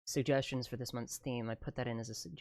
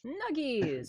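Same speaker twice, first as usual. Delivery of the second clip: excited; 12 semitones higher than usual. Pretend. Joking about the nugget sponsor.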